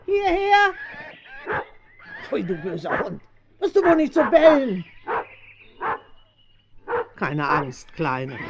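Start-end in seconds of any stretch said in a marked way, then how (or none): 0.0-0.7 loud, high shout across the yard; calling the dog. Acted. Farmer's wife shouting for her dog to come here, loud call. 2.3-4.9 loud, high telling-off; scolding the dog. Acted. Farmer's wife scolding her barking dog, loud and high.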